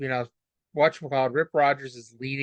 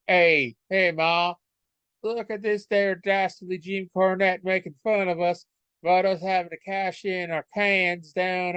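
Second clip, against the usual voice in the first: hammed-up country Southern accent, pitched up; fake Southern accent; 4 semitones higher than usual. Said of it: "me do my fake Southern accent".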